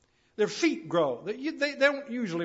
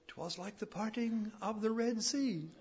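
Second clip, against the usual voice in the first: lightly voiced guide, higher and softer; tour guide's remark; 4 semitones higher, 5 dB quieter than usual. English guide remarks on the parting crowd, lightly put-on higher voice. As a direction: quote the guide in a slightly put-on voice. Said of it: "And our guide said".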